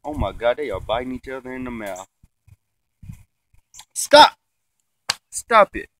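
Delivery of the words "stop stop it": shouting. Real, not acted. Yelling at dogs biting each other.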